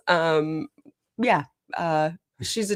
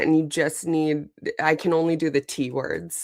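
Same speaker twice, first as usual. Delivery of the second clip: ordinary talk, nothing marked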